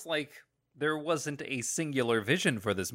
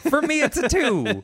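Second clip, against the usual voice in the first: louder, higher laughing insistence; insisting with a laugh, louder and higher; insistent; 11 semitones higher, 7 dB louder than usual. Real, not acted. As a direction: dig in on the verdict. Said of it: "It's still a two for me".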